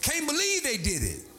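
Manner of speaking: high, ringing sermon climb; preaching at full force. Acted. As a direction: drive it home to the congregation.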